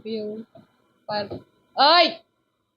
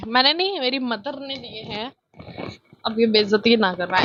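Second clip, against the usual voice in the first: normal talking voice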